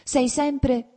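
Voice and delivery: high, loud reproach; reproachful outburst. A narrator's reading. Princess rounding on her husband, high and loud. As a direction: scold him in a sudden rush. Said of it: "cominciò a rimproverarlo".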